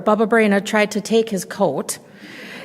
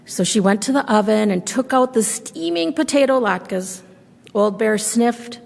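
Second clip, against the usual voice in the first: usual speaking voice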